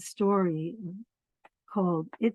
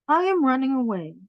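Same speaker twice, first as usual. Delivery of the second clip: little bunny; 5 semitones higher, 4 dB louder, faster than usual. Acted. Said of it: "he said to his mother".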